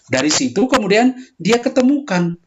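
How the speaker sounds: ordinary talk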